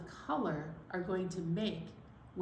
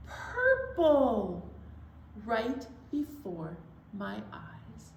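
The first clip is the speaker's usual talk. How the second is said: slow, rhythmic poem reading building higher and louder; reading a color poem to children; poem recital; 4 semitones higher, 3 dB louder, much slower than usual. Acted. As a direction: recite for preschoolers, rising to the surprise. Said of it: "This is what it sounds like".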